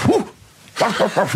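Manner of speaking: high yapping woofs; imitated dog bark. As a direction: bark suddenly like a startled dog. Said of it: "suddenly the dog goes". (hondengeblaf nadoen)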